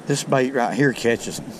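usual speaking voice

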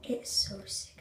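unvoiced hushed delight; quiet excited whisper. Real, not acted. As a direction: whisper it close to the mic. Whispering to camera.

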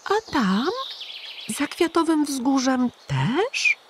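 put-on high childlike wondering question; a small boy amazed; curious little hedgehog. A narrator's reading. Little Igiełka asking the pigeon, high child voice. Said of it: "Dopytywał jeżyk".

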